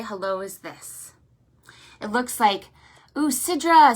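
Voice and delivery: ordinary talk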